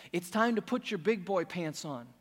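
ordinary talk, nothing marked

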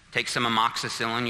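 usual speaking voice

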